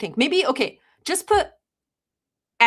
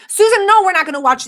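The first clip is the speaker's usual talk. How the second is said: loud, high outburst; sharp scolding; 10 semitones higher, 10 dB louder, faster than usual. Acted. Streamer refusing a viewer named Susan, loud scolding. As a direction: cut in loudly to shut it down.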